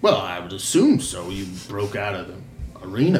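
low, understated innkeeper voice. Acted. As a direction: play a low-key innkeeper. Game master plays innkeeper.

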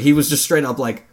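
usual speaking voice

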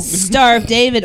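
usual speaking voice

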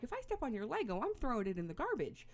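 high, loud, retold parental warning; exasperated parent threat. Parent warning kids after stepping on a Lego, exasperated. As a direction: lay down the law as a fed-up mom.

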